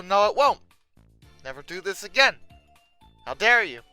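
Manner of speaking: usual speaking voice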